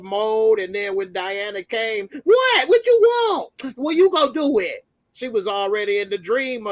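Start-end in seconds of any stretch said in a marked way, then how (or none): 2.2-4.8 high, loud imitation of a woman's annoyance; exaggerated loud, high-pitched demand; mock irritated outburst. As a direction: act out her impatient retort, way up in pitch. Voicing her reaction when Diana came.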